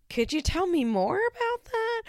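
high, sweetly probing interviewer voice; mock interviewer. Host pretending to interview Macy, high and probing. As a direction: coax her like a nosy producer. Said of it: "I'm like".

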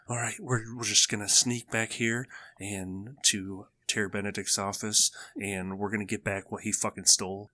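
low-key, soft conspirator's voice; hushed scheming. Acted. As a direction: keep it down, plotting a sneaky heist. Voicing sneaking thieves' hushed plotting.

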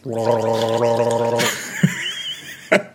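imitating gargling rap. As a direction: gurgle it like rapping through water. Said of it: "hang on, I'll demonstrate".

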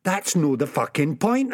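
usual speaking voice

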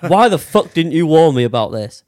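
mock fury, laughing through it; laughing mock outrage. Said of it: "It's like".

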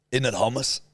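ordinary delivery

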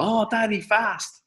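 high-pitched imitation of an excited child; small child's voice. Child to daddy from the back seat, high mimicked kid voice. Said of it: "Fionn was going".

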